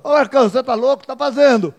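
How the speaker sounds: loud, high, fast disbelieving call; incredulous cellmate. Acted. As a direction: think he's gone mad. Cellmate mocking him.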